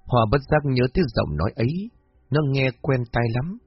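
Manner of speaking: ordinary delivery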